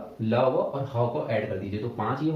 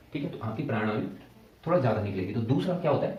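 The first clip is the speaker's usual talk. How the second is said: normal talking voice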